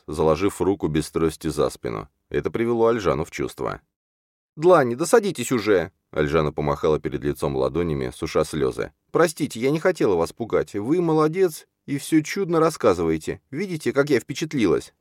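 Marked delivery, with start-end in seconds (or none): 4.6-5.9 high-pitched, impatient character voice; high, fussing plea; exasperated heroine. A narrator's reading. Heroine urges pacing man to sit. 9.1-14.9 high-pitched, earnest character voice; high, gushing reassurance; apologetic heroine. A narrator's reading. Tearful heroine apologises and praises him.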